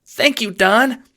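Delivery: very high, loud, drawn-out squeal; overwhelmed gratitude. A narrator's reading. Employee thanking the Don on his knees, high squeal. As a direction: gush it in a high, abject voice. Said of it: "you say, collapsing to your knees".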